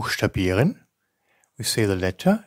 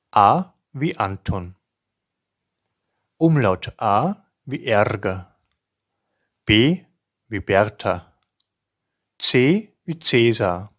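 each letter and name spoken slowly and distinctly with pauses; careful spelling dictation; 7 dB louder, slower than usual. Shown as a demonstration. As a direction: dictate it clearly, like spelling over the phone. Teacher reads Austrian alphabet.

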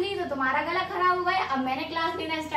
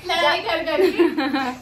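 laughing; 5 dB louder, much slower than usual. Real, not acted. Laughing about sisters' fights.

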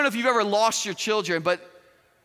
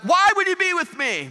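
high, raised-voice retort; acted shout back; 9 semitones higher, 4 dB louder than usual. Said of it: "Joseph shouts back".

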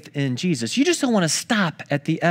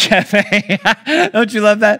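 laughing; 3 semitones higher, 5 dB louder, slower than usual. Real, not acted. Amused by Starbucks sign.

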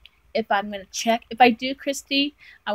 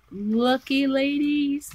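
ordinary talk

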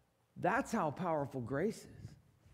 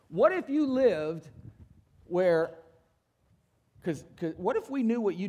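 loud challenge; 2 semitones higher, 7 dB louder than usual. Real, not acted. Preacher challenging congregation.

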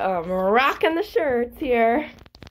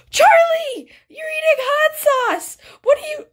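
very high-pitched, scandalized outcry; shocked squeal; 4 dB louder, slower than usual. Real, not acted. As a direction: call out a friend in high-pitched disbelief. Scandalized by Charlie eating hot sauce.

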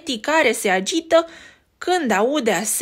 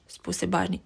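ordinary delivery

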